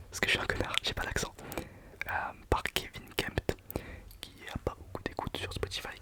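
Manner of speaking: usual speaking voice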